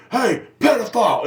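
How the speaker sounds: high, loud taunting yell across the street. Retold or imitated. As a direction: holler it at the neighbor like a drunk heckler. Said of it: "they're just screaming out loud".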